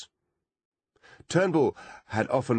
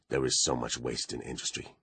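sighing; 6 semitones lower, 5 dB quieter than usual. A narrator's reading. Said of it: "Turnbull had sighed".

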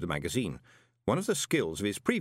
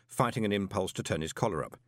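usual speaking voice

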